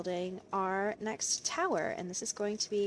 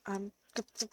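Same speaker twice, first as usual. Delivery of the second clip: ordinary talk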